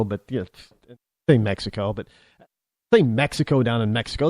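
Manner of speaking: usual speaking voice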